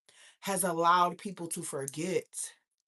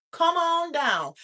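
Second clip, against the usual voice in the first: drawn out; 7 semitones higher, 3 dB louder, slower than usual. Acted. Hype invite, drawn-out repeat.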